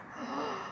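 sharp gasp. Retold or imitated. Surprised at brother's visit.

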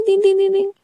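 high beeping tin tin; high-pitched mock beep sounds; imitated beeps. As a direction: mimic the test's electronic beep. Imitating digital test beeps.

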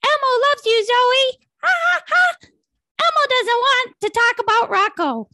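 high squeaky puppet voice; bright childlike falsetto; Elmo voice. Acted.

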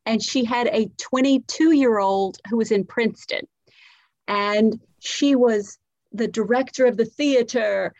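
ordinary talk, nothing marked

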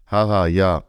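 ordinary delivery

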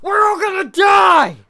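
huge high-pitched drawn-out shout; panicked American news. Acted. Mocking American Ebola coverage for a co-host, hysterical shout. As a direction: scream mock doom at the viewers. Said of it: "In America, like".

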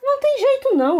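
grumbling. Acted. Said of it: "resmungou o monstro".

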